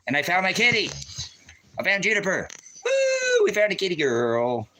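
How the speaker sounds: high, loud joyful whooping; excited celebration. Real, not acted. Announcing he found kitty Juniper, excited celebration. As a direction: shout it out high with delight. Celebrating finding his lost cat.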